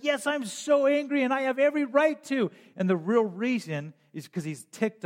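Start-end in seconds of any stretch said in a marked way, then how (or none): 0.0-2.5 higher, fast, petulant retort; sulking prophet snapping back; mock angry Jonah. Jonah snapping back at God, a higher mock angry retort. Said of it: "he's like".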